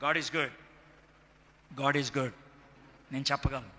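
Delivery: low, soft, sober declaration; hushed testimony. Real, not acted. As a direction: drop right down and affirm it quietly. Hushed testimony after loud climax. (మెల్లగా, తగ్గు స్వరంలో)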